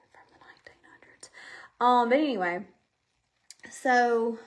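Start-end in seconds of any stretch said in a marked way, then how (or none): 0.1-1.3 "nineteen hundreds" breathed as a fast whispered aside; quick hushed echo of her kids' phrase. Retold or imitated. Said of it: "Whenever my kids say something".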